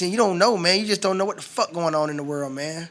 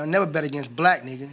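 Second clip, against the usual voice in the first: ordinary talk, nothing marked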